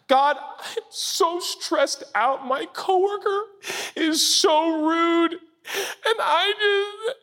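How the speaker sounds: hammed-up stressed whiner; mock-tearful complaining prayer; whiny complaint. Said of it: "prayer of a stressed believer".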